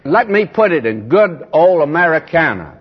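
ordinary delivery

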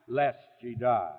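soft, low, weakened quote of Eve; watered down. Retold or imitated. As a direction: let it drop away softly. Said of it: "she's watering it down".

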